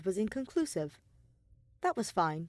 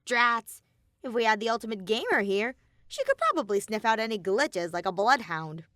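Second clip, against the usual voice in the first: Monokuma voice; 2 semitones higher, 5 dB louder than usual. Acted.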